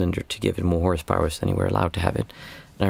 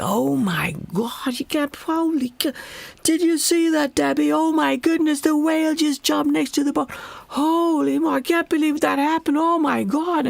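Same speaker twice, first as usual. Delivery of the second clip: squealing, much higher falsetto gush of awe; over-the-top tourist excitement. Retold or imitated. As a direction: gush like a thrilled tourist who just saw a whale. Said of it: "he was completely over the top".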